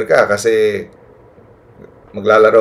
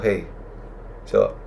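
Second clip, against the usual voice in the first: ordinary delivery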